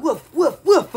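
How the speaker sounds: playful woofs; jokey doggy woofing; dog bark imitation. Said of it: "As a dog".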